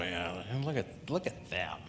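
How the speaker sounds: annoyed muttering aside; under your breath. Retold or imitated. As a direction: grumble it half to yourself, snide. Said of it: "under your breath go".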